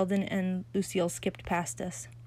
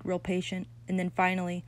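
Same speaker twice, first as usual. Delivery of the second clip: plain reading voice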